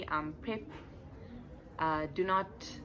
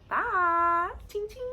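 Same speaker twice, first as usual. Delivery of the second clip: playful sign-off; 12 semitones higher, 4 dB louder, much slower than usual. Acted. Sign-off jingle sound.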